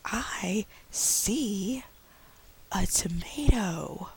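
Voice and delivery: slow, high, breathy model line; slow teacher model sentence. Shown as a demonstration. Teacher modeling the sentence slowly for a young learner. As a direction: model each word slowly for a child. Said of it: "be like".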